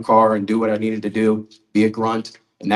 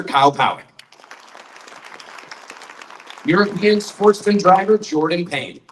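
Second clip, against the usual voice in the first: emcee announcement; 8 semitones higher than usual. Acted. Emcee presenting honorees to the crowd, announcer voice. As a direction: call each name out to the crowd like an emcee.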